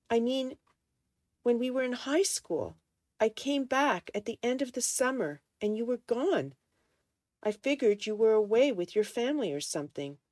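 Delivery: high-pitched, hurt account; aggrieved explaining. A narrator's reading. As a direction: plead your side in a quarrel. Man pleads his side in quarrel.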